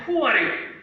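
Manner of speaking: high, loud challenge; boy's scream. Acted. Little boy to the unknown voice, screaming. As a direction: call up at the mountain as an alarmed little boy.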